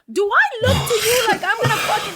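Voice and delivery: high-pitched, incredulous comic protest; mock outrage. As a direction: balk at an absurd request, voice shooting up. Acts out outraged reply to audition request.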